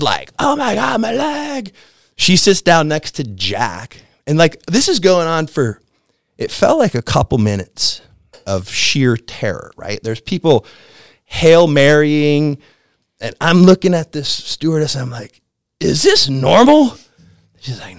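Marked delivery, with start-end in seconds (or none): 0.4-1.7 much higher, yelping in pain; a mock cry of pain after a blow; imitated pained cry. Stewardess hit by coffee cart. 15.8-17.0 much higher, louder, slow frightened ask; nervous passenger asks the stewardess; panicked question. Retold or imitated. Asking stewardess during turbulence.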